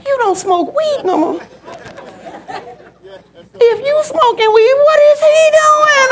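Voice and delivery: high, strained disbelief, acted out; hammed-up horror climbing in pitch; shocked. Mother learns son's girlfriend smoked weed, shocked. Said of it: "my mouth went, "What?".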